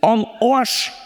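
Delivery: a hearty Bavarian "am Arsch" with a rising end; Bavarian accent. Shown as a demonstration. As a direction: say "am Arsch" like a Bavarian local would. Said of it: "sag's mal auf Bayerisch".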